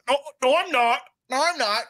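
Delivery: put-on high, whiny kid protesting; whiny thirteen-year-old. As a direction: squeak it like a teen denying it. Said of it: "voice of a thirteen-year-old".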